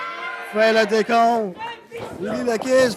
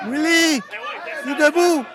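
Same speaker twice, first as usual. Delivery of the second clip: wrestling commentator; 5 semitones higher than usual. Acted. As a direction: build the hype for the big move. Live wrestling play-by-play. (commentateur de catch qui s'emballe)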